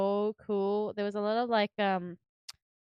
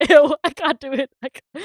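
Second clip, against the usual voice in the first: high, loud laughing refusal; giggly embarrassed ew, much louder; laughing cringe; 5 semitones higher, 14 dB louder than usual. Real, not acted. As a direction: squeal it out through laughter. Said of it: "I was gonna try and do Bakugo".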